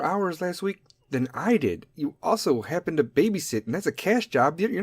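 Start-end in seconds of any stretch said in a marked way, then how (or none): none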